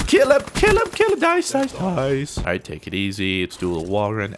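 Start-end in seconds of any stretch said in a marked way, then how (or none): 0.1-2.4 high urgent yelling; frantic yelling. Real, not acted. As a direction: scream at them to finish him. Frantic urging during firefight.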